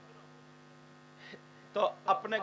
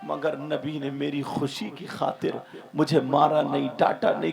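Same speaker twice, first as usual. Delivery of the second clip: ordinary talk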